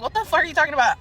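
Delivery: baffled retort. Retold or imitated. Friend answering the narrator's water claim, baffled. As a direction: retell it in loud disbelief.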